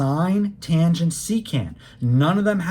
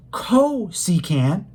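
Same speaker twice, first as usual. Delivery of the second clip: "cosecant" with CO stretched and punched; slow down and hit the "co" in "cosecant"; stress on CO; 2 semitones higher, much slower than usual. Shown as a demonstration. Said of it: "hear me emphasizing the word co".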